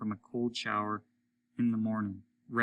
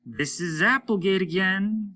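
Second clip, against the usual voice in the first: higher, louder gruff voice of Applegate; a rough spaceman calling in, raised voice; Applegate over the radio; 8 semitones higher, 6 dB louder than usual. A narrator's reading. Applegate calling Hollis over the radio, higher and louder.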